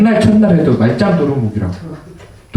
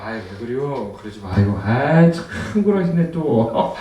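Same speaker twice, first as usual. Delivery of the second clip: much softer, lower mild Chungcheong voice; soft Chungcheong style; 6 semitones lower, 4 dB quieter than usual. Retold or imitated. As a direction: murmur it gently like a polite Chungcheong lady. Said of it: "충청도는 부드럽지 않아".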